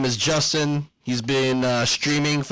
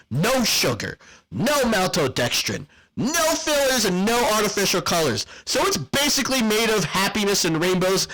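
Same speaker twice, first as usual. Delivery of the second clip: ad read; 6 semitones higher than usual. Acted. Hyped sponsor ad read.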